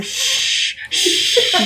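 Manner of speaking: unvoiced shh rising into a laughing squeal; hush, then crack up on the second shh; mock shushing. Friend imitating the shushing, laughing. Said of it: "while going".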